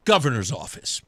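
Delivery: low, matter-of-fact statement; understated official introduction; matter-of-fact answer. Retold or imitated. Tim's answer as retold.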